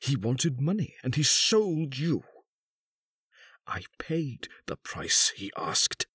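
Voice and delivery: high, deliberate recital swelling to an outburst; bitter accusation. A narrator's reading. Husband recounts the bought marriage, bitterly accusing. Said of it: "The old man continued".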